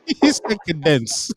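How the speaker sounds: laughing, drawn-out echo of "cadence" as kuh-DENCE; slowly mock the slip "cadence", stressed at the end, while laughing; teasing imitation. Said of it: "Did you just say cadence".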